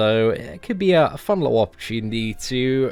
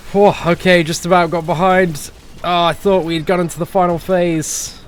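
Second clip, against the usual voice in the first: higher, louder burst after a close call; flustered relief; 8 semitones higher, 5 dB louder than usual. Real, not acted. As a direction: blurt it out just after narrowly escaping. Narrow escape during boss fight.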